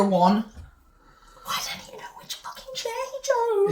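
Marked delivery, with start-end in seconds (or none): 1.4-3.7 exasperated aside. Real, not acted.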